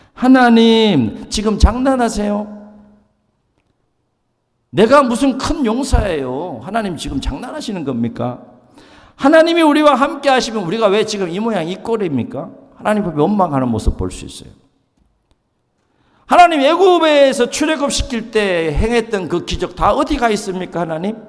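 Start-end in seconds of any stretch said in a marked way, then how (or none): 0.1-12.5 high, loud, aggrieved protest to God; complaining Gideon. Retold or imitated. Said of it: "불평하고 원망합니까". 16.3-21.2 high, loud, aggrieved protest to God; complaining Gideon. Retold or imitated. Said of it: "계속 원망하고 불평합니다".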